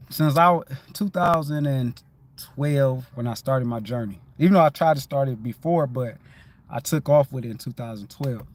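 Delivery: ordinary delivery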